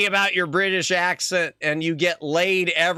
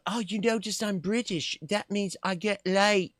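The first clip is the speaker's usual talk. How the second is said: mock British swagger; 4 semitones higher, 4 dB quieter than usual. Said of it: "You know".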